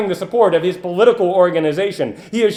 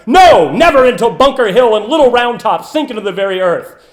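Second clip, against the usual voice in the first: louder, ringing defiance; defiant oratory; 4 semitones higher, 7 dB louder than usual. Acted. Orator defying surrender before citizens, loud and defiant. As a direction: hurl the no, never at the crowd.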